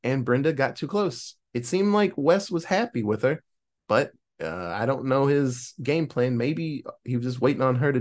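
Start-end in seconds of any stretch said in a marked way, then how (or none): none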